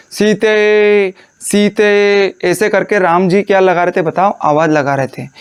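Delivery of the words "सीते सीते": long drawn-out call of "सीते"; calling out Sita. Shown as a demonstration. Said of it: "कैसे पुकार रहे थे?".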